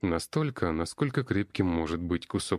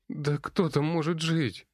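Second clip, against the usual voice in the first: moaning; 10 semitones higher, slower than usual. Acted. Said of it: "простонала девушка".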